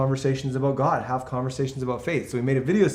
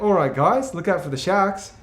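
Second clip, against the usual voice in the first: Australian accent; 5 semitones higher than usual. Shown as a demonstration. Visitor warns friends about sharks in a hammed-up Australian accent. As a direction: play the cheery Aussie lifeguard. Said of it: "I like to talk about sharks".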